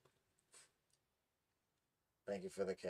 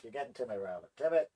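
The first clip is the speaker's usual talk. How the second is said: normal talking voice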